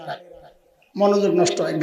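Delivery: normal talking voice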